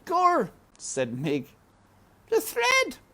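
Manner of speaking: very high, loud blurts; flustered Mig. A narrator's reading. Mig remembering the thread, high and loud. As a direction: remember it suddenly.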